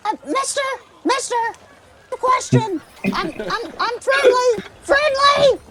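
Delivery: high, squeaky nervous goblin. Acted. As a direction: pitch way up, a timid little goblin. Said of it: "your goblin voice is what makes this".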